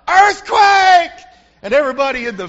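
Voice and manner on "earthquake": high, loud desperate scream. Retold or imitated. Prisoner screaming to fool the executioners, loud scream. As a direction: yell it to cause panic. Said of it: "screams out".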